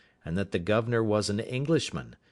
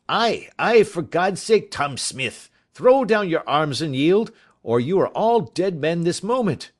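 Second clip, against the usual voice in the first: high, loud urgent call to surrender; urgent shout; 9 semitones higher, 10 dB louder than usual. A narrator's reading. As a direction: yell the warning across the water. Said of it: "The other answered".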